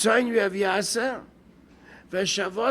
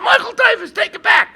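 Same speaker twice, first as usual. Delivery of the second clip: much higher, much louder frantic yell; desperate shout; 14 semitones higher, 11 dB louder than usual. Retold or imitated. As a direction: scream it to the heavens, begging. Lotto winner hounded by relatives.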